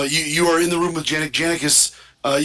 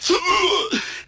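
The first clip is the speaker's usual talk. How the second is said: gasp. Retold or imitated. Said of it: "and you're like".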